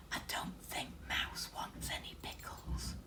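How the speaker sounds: soft secret whisper. A narrator's reading. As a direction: whisper it to the children. Narrator whispers aside.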